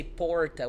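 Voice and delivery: PORTA with R as in English "door"; American-style R in "porta"; English retroflex R. Shown as a demonstration. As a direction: curl the tongue back for "porta". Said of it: "with this English R".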